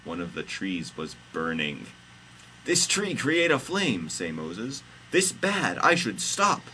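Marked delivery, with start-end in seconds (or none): 2.6-4.1 high-pitched storybook exclamation; high comic hero voice; Moses character voice. Acted. Moses' quoted speech in story. 5.1-6.6 high, slightly raised hero voice; high-pitched comic dialogue; Moses character voice. Acted. Moses' quoted speech in story.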